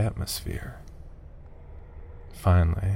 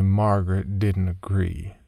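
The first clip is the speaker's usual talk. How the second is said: plain reading voice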